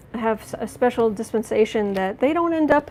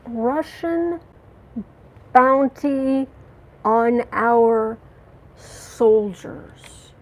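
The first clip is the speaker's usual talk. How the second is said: outraged; 4 dB louder, much slower than usual. Real, not acted. Outraged question about bounty.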